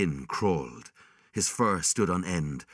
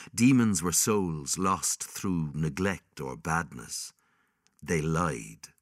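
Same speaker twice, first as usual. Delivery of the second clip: ordinary delivery, nothing marked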